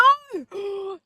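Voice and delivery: high, loud gasp; shocked gasp. Real, not acted. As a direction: gasp in mock horror. Shocked at seeing sixty-seven.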